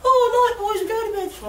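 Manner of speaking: high-pitched imitation of his mum; mum's voice. Mum saying goodnight to the lads, high imitated voice. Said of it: "She said".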